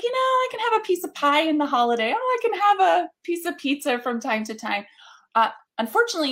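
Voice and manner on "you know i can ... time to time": higher, louder, mock-casual excuse making; playful self-justification; self-indulgent inner voice. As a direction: talk yourself into a treat, lightly. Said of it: "I was like".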